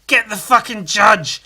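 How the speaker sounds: loud, high, slow mob shout; rally the crowd against him; puppet mob yell. Acted. Angry Muppets yell to attack the judge, loud slow shout. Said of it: "the puppets go".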